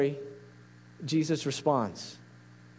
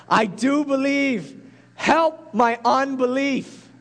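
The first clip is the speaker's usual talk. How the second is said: higher, louder, slowed plea leaning on "do" and "help"; desperate cry; 8 semitones higher, 4 dB louder, slower than usual. Acted. Preacher voicing the desperate father crying out to Jesus. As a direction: cry it out like a broken father begging. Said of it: "the boy's father cried out".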